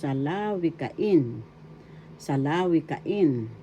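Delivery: salawikain, slowly, with IN stressed and drawn out; slow teaching model of salawikain, accent on IN; stress on fifth syllable. Shown as a demonstration. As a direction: stretch the final in of salawikain. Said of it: "this is how it is pronounced".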